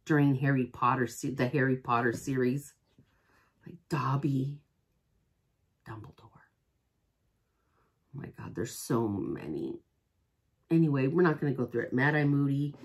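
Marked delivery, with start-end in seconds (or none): 3.7-9.8 barely-there whisper of lost characters; hushed grief. Real, not acted. Fan listing dead characters, hushed and sad. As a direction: trail off sadly, much softer.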